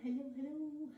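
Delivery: soft, lilting hello into the void; calling out for anyone there. Real, not acted. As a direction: call out gently to see who has joined. Checking if viewers have joined online.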